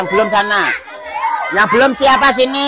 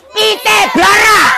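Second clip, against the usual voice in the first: loud call; 11 semitones higher, 4 dB louder, slower than usual. Acted. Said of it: "Kurang keras".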